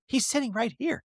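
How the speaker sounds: high-pitched imitation of an exasperated wife; mock exasperation. Voicing wife's exasperated thought.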